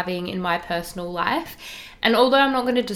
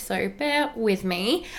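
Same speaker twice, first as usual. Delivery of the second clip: usual speaking voice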